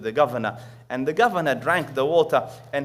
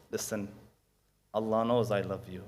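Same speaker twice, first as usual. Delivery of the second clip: tender parent; 5 semitones lower, 8 dB quieter than usual. Retold or imitated. Parent tells child tender words.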